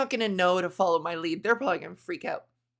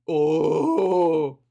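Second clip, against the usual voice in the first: long, loud, low "oh"; exasperated groan; 4 semitones lower, 7 dB louder than usual. Real, not acted. Comic who lost the thread, long exasperated groan.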